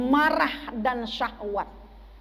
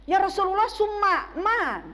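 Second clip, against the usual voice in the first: higher questioner, lightly acted; man asking the Prophet; 6 semitones higher than usual. Man asks Rasulullah what comes next, lightly voiced higher questioner. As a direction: ask for more in a higher voice, drawing out the end. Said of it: "ditanya oleh seorang laki-laki".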